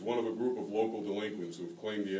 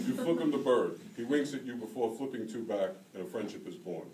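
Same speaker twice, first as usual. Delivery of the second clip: usual speaking voice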